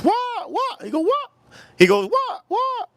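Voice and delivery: very high squeaky imitation of father; father's squeak. Imitating father confused at sushi place, squeaky. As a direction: mimic dad in a tiny high squeal.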